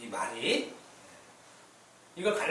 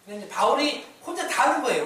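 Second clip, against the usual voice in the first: ordinary delivery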